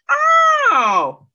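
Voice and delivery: high, loud sudden oh; startled exclamation, real; surprised oh. Real, not acted. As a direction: yelp it as the idea lands. Sudden reaction to chat.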